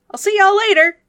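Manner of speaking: high, loud cheery farewell; a folksy local calling goodbye; Rudy's voice. Acted. Game master voicing Rudy riding off on his bike.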